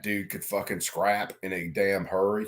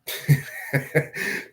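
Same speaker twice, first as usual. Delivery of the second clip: breathy laugh; 6 semitones higher than usual. Real, not acted. Laughing at own mistake.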